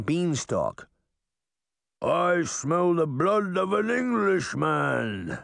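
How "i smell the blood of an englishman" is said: slow, drawn-out giant voice; higher, stretched-out storybook giant; giant's bellow. A narrator's reading. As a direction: boom it out slowly like a giant. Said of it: "the giant bellowed".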